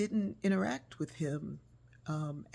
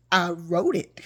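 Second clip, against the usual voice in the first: proud reveal; 2 semitones higher, 13 dB louder, slower than usual. Retold or imitated. Author reveals herself, proud.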